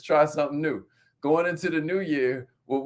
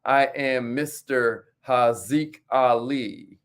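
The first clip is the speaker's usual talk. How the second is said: name stretched out very slowly; slow, deliberate name; 3 semitones lower, much slower than usual. Shown as a demonstration. Host giving his Instagram handle to viewers, drawn out slowly. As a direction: say the handle slowly so they catch it. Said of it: "Or on Instagram".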